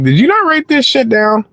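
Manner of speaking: high, incredulous disbelief at the waitress; reliving the annoyance at a careless server; exasperated retort. Retold or imitated. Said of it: "I was like".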